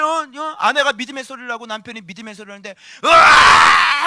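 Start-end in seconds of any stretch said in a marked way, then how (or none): 3.0-4.0 크고 길게 내지르는 고함 흉내; 상대에게 악쓰는 소리를 흉내 내기. Retold or imitated. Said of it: "하고 소리 질렀어". (길게 악쓰는 소리)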